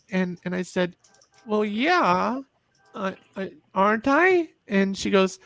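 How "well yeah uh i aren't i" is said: put-on higher, halting child's voice rising at the end; hesitant child. Child answering mom hesitantly, higher rising voice. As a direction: falter then ask hopefully.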